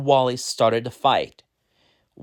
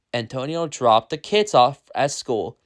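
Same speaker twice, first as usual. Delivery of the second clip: ordinary delivery, nothing marked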